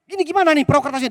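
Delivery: put-on high, loud, fast child's panic; a kid watching his boat drift away; frantic little boy, rushed and loud; panicking child. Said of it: "Anak kecil ini panik".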